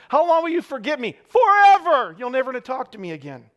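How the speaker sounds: put-on high, loud melodramatic teen whine; exaggerating teenager. David voiced as a dramatic teenager, high and loud. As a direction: overdo it like a sulky teenager. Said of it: "Teenagers are so great at the exaggeration".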